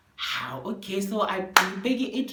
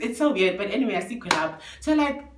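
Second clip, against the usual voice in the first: ordinary talk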